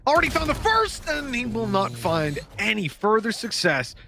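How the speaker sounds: high-pitched live esports call; urgent match commentary; excited play-by-play. Real, not acted. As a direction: drive the commentary up to a peak, then settle.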